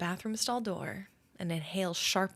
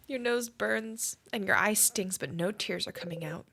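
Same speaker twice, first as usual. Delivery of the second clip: normal talking voice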